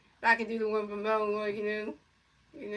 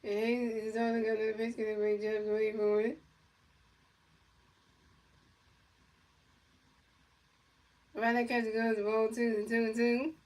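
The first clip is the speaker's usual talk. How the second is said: play-by-play announcer. Pretend. Kid calling a pretend Mets game, announcer style. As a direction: pretend baseball commentator calling the pitches.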